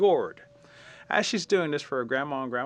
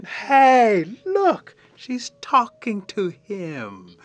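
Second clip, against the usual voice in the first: high, slow, drawn-out excited cry; excited warriors; 9 semitones higher, 9 dB louder, much slower than usual. Acted. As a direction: gasp it out like thrilled young warriors. Warriors amazed she talks to bug.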